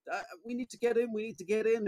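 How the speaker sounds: put-on high, urgent kid voice; kid at the door. Child needing the bathroom, in a high pleading voice. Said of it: "they're like".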